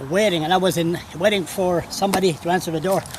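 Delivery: ordinary talk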